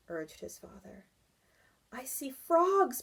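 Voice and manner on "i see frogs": put-on higher, excited child call; a mild child's shout, slow. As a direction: call out the discovery. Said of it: "Barry shouted".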